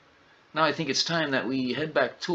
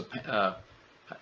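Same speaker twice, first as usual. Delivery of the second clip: ordinary delivery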